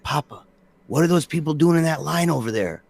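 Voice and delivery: a hint of a curious kid tugging at dad; mild kid voice asking about people in line; small boy's voice. Dad voicing his small son.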